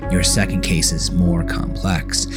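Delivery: plain reading voice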